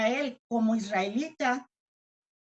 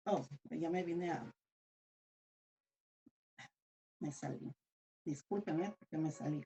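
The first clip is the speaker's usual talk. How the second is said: low, much softer aside; murmured aside; 8 semitones lower, 11 dB quieter than usual. Real, not acted. Preacher apologizing for losing the verse, murmured aside. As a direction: mumble it to yourself, off the sermon.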